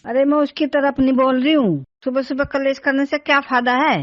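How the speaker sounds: loud, exasperated protest; scolding retort. Acted. Mother denies taking her son's side to father, scolding loudly. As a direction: push back loudly against the accusation.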